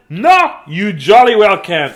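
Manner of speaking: high, loud shouted no; indignant refusal. A narrator's reading. Dudley refusing his siblings entry, loud and indignant. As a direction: refuse loudly, punching the first word.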